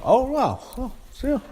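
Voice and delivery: high-pitched stunned "oh, wow"; surprised. Real, not acted. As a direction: voice jumps up in disbelief. Reacting to shock heel turn.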